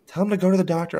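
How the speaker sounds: husband imitates wife urging a doctor visit, mock insistent. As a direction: push it like a nagging wife.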